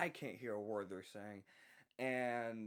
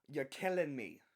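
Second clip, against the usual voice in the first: put-on New York accent, pitched up and louder; raised-voice New York accent impression; mock New York accent; 5 semitones higher, 7 dB louder, slower than usual. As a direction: imitate an angry New Yorker complaining. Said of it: "in that specific accent".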